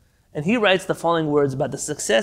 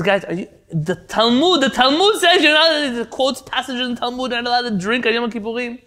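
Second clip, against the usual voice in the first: insistent; 7 semitones higher, 4 dB louder than usual. Retold or imitated. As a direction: hammer the Talmud at them. Leibel quotes the Talmud.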